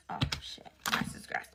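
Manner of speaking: breathless hushed dread; panicked whisper. Retold or imitated. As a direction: whisper it as mom comes down. Hears mom coming downstairs.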